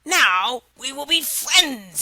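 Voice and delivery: slow, changed-timbre frog; slow odd frog voice. Acted. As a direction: say it slowly as the pleased frog.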